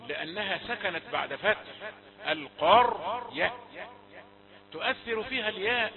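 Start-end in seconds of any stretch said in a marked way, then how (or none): 2.3-3.5 القرية said slowly, heavy full-mouthed ra; slow careful demo. Shown as a demonstration. Teacher demonstrating the correct reading of القرية. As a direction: draw out القرية with a thick emphatic r. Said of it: "بإسراع القارئ فيقول القرية".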